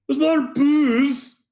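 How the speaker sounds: very high, drawn-out blacksmith voice; high, stretched comic question; booze-seeking blacksmith. Acted. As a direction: ask it slowly in a squeaky-high voice. Blacksmith leans over to ask.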